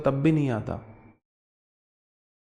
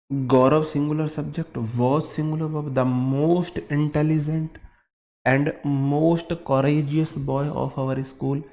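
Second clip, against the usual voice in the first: slow dictation; much slower than usual. Shown as a demonstration. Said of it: "dictating sentence slowly".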